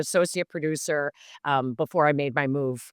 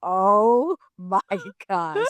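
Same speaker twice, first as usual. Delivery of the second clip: laughing oh my gosh; 4 semitones higher, 5 dB louder, much slower than usual. Retold or imitated. Group reacting, laughing.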